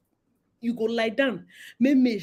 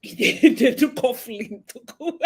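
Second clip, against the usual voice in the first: words through real laughter; laughing. Real, not acted. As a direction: laugh as you repeat it.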